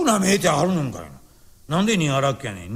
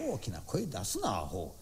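sharp low hush, much softer; hushed scolding; 12 semitones lower, 14 dB quieter than usual. Acted. Scolding a noisy friend at the secret game, hushed. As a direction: scold him under your breath so no one hears. Hushing a loud friend at gambling.

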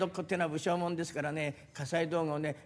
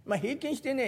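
normal talking voice

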